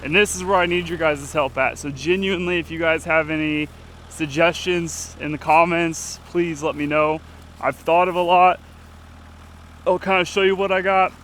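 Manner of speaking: ordinary talk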